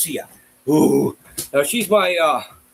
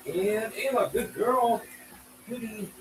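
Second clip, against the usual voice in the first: high dog praise; 9 semitones higher, 7 dB quieter than usual. Real, not acted.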